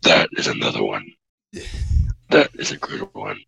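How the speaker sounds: low, threatening-style growl of a drop. Real, not acted. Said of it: "Stop lowering your voice like that".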